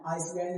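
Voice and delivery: आइसलैंड with a sounded s, "ice-land"; wrong s sounded. Shown as a demonstration. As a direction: misread आइसलैंड as "ais-land". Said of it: "इसे नहीं पढ़ना है".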